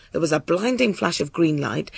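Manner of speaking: ordinary delivery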